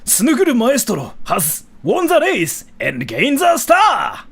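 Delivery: high, shouted race-winner announcement; Nippon Marathon announcer. Shown as a demonstration. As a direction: announce the winner at full hype. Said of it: "you really sound like the announcer from Nippon Marathon".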